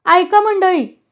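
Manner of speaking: acted yell over the noise; shouted call. A narrator's reading. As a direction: call the crowd to order. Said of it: "पुन्हा गुरव ओरडला".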